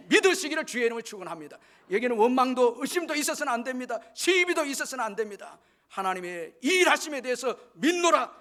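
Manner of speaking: high, loud sermon exhortation; full-force preaching. Real, not acted. Preacher urging the congregation to believe without doubt, full-force preaching.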